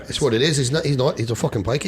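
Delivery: thick put-on Irish accent; quick, lilting mock Irish. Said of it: "Well, he sounds like that".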